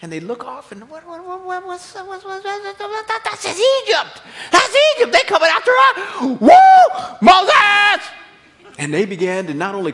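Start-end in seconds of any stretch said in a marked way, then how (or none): 0.9-8.1 rising, then a high, loud panicked shout; frantic people screaming for Moses; panicked crowd. Acted. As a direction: cry out in terror as the army appears. Israelites panic seeing Egypt.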